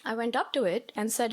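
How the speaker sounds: normal talking voice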